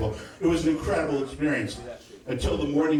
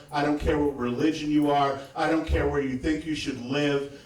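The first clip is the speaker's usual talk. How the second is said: ordinary talk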